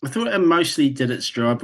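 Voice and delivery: ordinary talk, nothing marked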